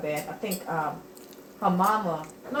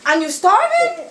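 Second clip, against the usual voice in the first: higher, louder, slow teasing question; mock surprise; 12 semitones higher, 7 dB louder, slower than usual. Grandma teasing her returned grandson who says he's hungry, slow and high. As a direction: drag it out, teasing him.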